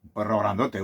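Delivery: ordinary talk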